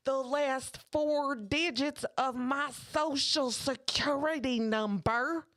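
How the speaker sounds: earnest Southern lady impersonation; Southern accent. Retold or imitated. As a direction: savour every word in exaggerated Southern. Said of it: "I did the accent".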